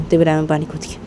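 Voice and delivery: usual speaking voice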